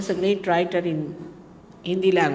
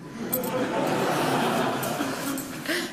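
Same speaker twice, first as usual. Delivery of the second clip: a quiet breathy chuckle; short airy laugh after a request; soft laugh; 6 semitones higher, 6 dB quieter than usual. Real, not acted. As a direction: laugh it off, a little sheepish. Laughs after asking not to record.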